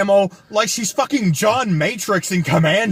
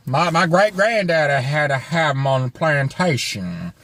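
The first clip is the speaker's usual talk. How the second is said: lower-pitched mock Louisiana old-timer; bad Louisiana accent; 5 semitones lower than usual. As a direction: ham up a Louisiana plantation-country accent. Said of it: "I can't do a Louisiana accent".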